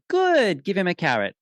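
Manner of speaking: high, sing-out approval; delighted praise. Retold or imitated. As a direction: swoop up high on the praise, then ease down. Praising the horse's outburst, retold.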